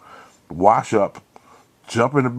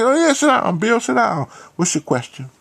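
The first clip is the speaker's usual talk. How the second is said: Martha inviting him to sit; 14 semitones higher than usual. Retold or imitated. Martha voiced in retold story.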